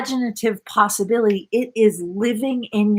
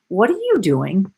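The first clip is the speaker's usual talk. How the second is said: ordinary delivery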